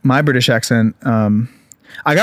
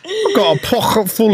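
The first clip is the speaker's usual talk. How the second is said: high, hammed-up British accent; mock-British, pitched right up; 8 semitones higher than usual. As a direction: put on a silly high British voice. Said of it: "have to be in British accent".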